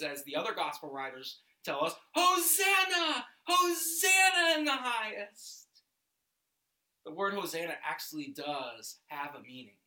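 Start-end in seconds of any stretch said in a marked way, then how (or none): 2.1-5.8 high, loud cry of praise; shouts of acclamation. Acted. Crowd welcoming Jesus into Jerusalem, shouting acclamation. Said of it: "These shouts of acclamation and praise".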